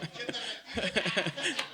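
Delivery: real bubbling laugh. Real, not acted. Teacher joking with the congregation, laughing. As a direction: chuckle helplessly for a moment.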